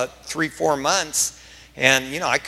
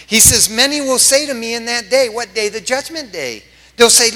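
high, loud scripture proclamation; emphatic preaching; 7 semitones higher, 6 dB louder than usual. Real, not acted. Pastor proclaiming Jesus' words on judgment day, emphatic preaching. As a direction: declaim the verses with urgent pulpit force.

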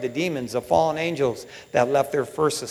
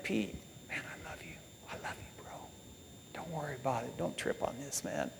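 very soft, near-whispered comfort; hushed, loving and forgiving; tender reassurance; 18 dB quieter than usual. Retold or imitated. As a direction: reassure a hurting friend almost under your breath. Imagines Jesus reassuring Peter lovingly.

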